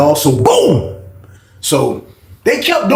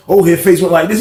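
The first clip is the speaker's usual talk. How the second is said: ordinary talk, nothing marked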